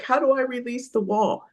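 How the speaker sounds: high-pitched mock bewilderment; baffled person. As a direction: imitate a confused person protesting. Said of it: "that person's like".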